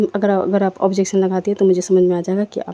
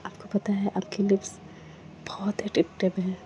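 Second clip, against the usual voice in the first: soft murmur; 9 dB quieter than usual. Acted. Aditi praising Myra's lips.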